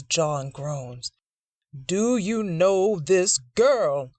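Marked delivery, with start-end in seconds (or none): 1.7-4.1 drawn-out, heavy demand; slow stern demand. Acted. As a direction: press each word slowly. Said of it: "Preacher Dixon demands again".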